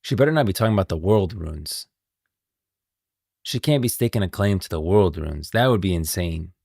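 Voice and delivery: usual speaking voice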